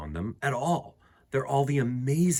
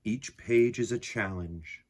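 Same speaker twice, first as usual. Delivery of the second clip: calm, softer read-aloud voice with unhurried pauses; gentle poem reading; 4 semitones lower, 4 dB quieter than usual. A narrator's reading. Reading the picture book poem to listening children, calm and soft. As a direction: read it like a quiet bedtime poem.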